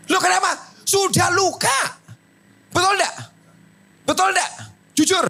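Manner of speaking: preacher shouting at the congregation; preaching shout. Real, not acted. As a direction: drive it home at full force.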